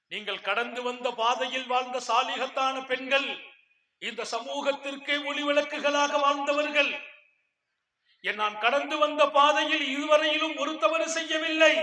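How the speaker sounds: high and loud rousing sermon; loud pulpit praise; preaching at full force. Acted. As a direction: lift the mothers up with a fiery call. Praises the pious women of old.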